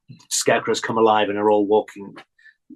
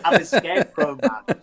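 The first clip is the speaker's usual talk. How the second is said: quoting the scarecrow again, higher with a laugh; repeating the scarecrow line; 5 semitones higher than usual. Retold or imitated. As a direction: relish the creepy line, giggling. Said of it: "I think it's something like".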